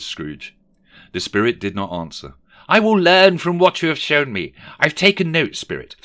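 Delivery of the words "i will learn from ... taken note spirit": loud, high dramatic pledge; fervent vow. Acted. Scrooge promising the spirit he will learn, fervent. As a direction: swear it with full conviction.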